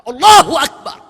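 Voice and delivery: loud, full-voiced takbir cry; spirited takbir. Shown as a demonstration. Preacher showing how to read takbir, spirited loud cry. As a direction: proclaim it with full power. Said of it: "Penuh semangat".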